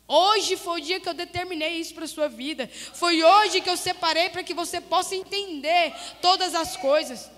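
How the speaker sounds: forceful voice of God, retold in preaching; pregação fervorosa. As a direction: press each question home, stretching key words. Preaches God's words forcefully. (voz de pregação, firme e cobradora)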